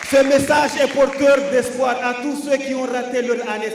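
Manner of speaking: loud mock public announcement; punchy stand up comedy. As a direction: proclaim it like good news.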